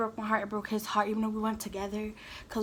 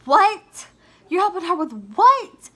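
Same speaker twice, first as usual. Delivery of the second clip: much louder and higher, indignant; outraged disbelief; 7 semitones higher, 11 dB louder, slower than usual. Retold or imitated. Retelling her reply to the boyfriend at his ex's, outraged shout. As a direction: fire it back like you can't believe it.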